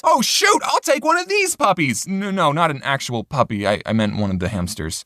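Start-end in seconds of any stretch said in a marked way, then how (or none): 0.0-2.1 high, excited put-on voice; higher, excited exclamation; mock excited. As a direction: gush over the cute pets. Excited about sale hamsters.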